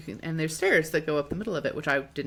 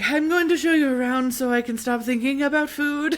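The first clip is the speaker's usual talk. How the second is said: loud, higher, declaiming guard voice; hammed-up guard, loud and raised; announcing guard; 8 semitones higher, 6 dB louder than usual. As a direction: proclaim it like a put-on tour offer.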